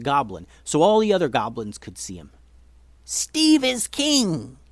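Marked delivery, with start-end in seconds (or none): 3.0-4.6 very high, drawn-out yell; proclaiming yell. Acted. New king hailed before all the goblins, yelled high. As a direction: cry it out slowly to a whole crowd. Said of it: "he yelled".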